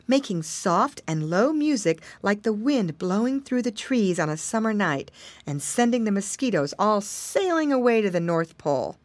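ordinary delivery

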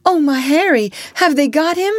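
high, loud cry of alarm; panicked mother. A narrator's reading. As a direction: cry out in panic for your child.

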